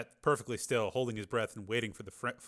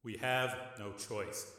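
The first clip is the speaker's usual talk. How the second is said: softer, slow, calm and steady; calmly; 8 dB quieter, slower than usual. A narrator's reading. Rick calming his furious brother, slow and soft. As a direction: settle him down. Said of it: "said Rick calmly".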